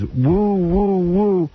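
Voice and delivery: high, drawn-out hooting woos; imitated woo calls. Sports fan's endless crowd call, imitated high woos. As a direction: hoot it like the fan at games. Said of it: "he sits there and goes".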